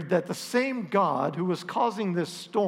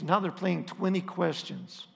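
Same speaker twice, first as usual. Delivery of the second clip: ordinary delivery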